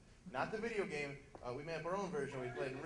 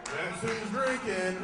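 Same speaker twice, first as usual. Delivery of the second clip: loud, slow triumphant declaration; gleeful announcement; 4 semitones higher, 10 dB louder, much slower than usual. Retold or imitated. Comic owning up at drinking game, loud slow gleeful boast. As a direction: drag it out and proclaim it. Punchline declared loudly, slowly.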